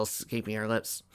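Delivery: usual speaking voice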